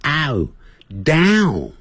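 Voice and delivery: "ow，down" with ah gliding into a rounded close; correct down. Shown as a demonstration. Teacher models the right vowel in down for learners. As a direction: open wide then round the lips on "down". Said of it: "啊，嘴巴在收".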